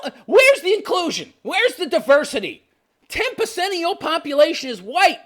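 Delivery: high, hammed-up indignant protest; mock outrage. Reactor mocking protesters over diversity at an event, mock outrage. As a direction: cry out in exaggerated, high-pitched outrage.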